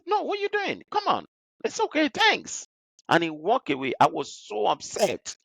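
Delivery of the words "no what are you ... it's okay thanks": pitched well up, slowed, mock polite refusal; director refusing help. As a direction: wave off the help, overly polite.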